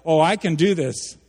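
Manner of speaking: loud, cocky boast; brash self-assured claim; overconfident. Shown as a demonstration. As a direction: sell it like you've got this all handled. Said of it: "drawn to one pole or the other".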